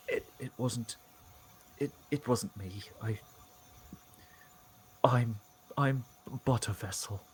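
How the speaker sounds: quiet, halting beaten priest; faltering priest. Acted. Fitzgerald disowning blame while lying beaten, halting defeated priest. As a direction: stammer it out in broken pieces.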